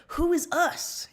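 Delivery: insistent question; pressing. Acted. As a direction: press them hard for an answer. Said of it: "You press".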